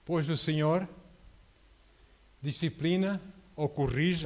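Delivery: measured, solemn reading of the verse; scripture reading. Acted. Preacher reading Hebrews to the congregation, solemnly. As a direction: read the verse slowly and weightily.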